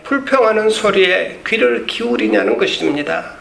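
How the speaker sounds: usual speaking voice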